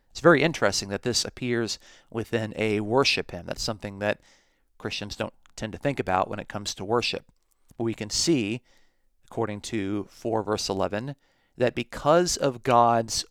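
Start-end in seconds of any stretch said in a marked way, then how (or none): none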